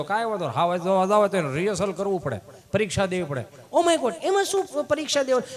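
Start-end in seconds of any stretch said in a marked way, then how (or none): 3.7-5.6 higher, affected Oh my God; prissy urban tourist exclaiming; mock shocked city visitor. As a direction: ham up a fussy guest aghast at a test.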